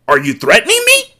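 high-pitched cartoon squawk rising at the end; Cornholio. Reader slipping into a cartoon while voicing Zaire, Cornholio voice. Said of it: "I can't say it without sounding like Cornholio".

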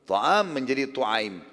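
Ta'am then tu'aim spelled out very slowly; Arabic diminutive. Shown as a demonstration. Teacher showing how Ta'am becomes tu'aim, very slow. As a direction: stretch Ta'am and tu'aim to show the vowel change. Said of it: "diganti fathah pertama menjadi dhammah".

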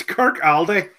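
"Kirkaldy" slowly split as Kirk-ALDY; "Kirkaldy" in two careful parts, L pronounced; mispronounced place name. Real, not acted.

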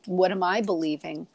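ordinary talk, nothing marked

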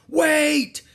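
very high, loud yell; desperate cry. Acted. Captain as crew pushes off rowboat, crying out. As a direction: yell to be waited for.

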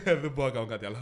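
imitating an amused official; retold brush-off with a bit of a laugh; laughing dismissal. Port official brushing off the traveller, mock laughing. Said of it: "γελάει και μου λέει".

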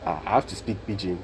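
ordinary delivery

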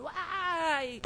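"Uai" alto e agudo, cheio de entusiasmo; exclamação animada. Pretend. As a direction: solte o "Uai" como quem acorda feliz. Exclamação ao começar o dia. ("Uai" animado e agudo)

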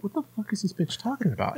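quick, hushed inner voice; inner thought, muttered. Retold or imitated. Retelling his private reaction to the neighbor, fast and hushed. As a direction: rattle it off under the breath, fast. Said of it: "And I was like".

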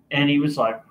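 normal talking voice

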